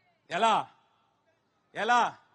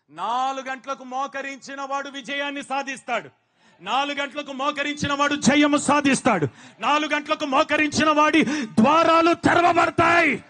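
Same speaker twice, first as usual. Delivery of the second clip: from plain to loud shout over the repeats; building preaching shout; 4 semitones higher, 10 dB louder than usual. Acted. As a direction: push each repeat louder than the last. (పెరుగుతూ అరుపుగా మారే ప్రసంగం)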